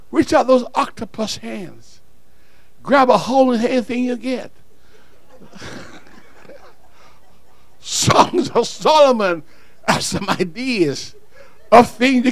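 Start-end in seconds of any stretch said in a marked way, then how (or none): 4.6-7.7 high unvoiced giggle; soft airy laugh; breathy giggle. Real, not acted.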